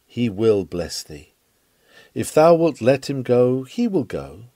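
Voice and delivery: ordinary talk, nothing marked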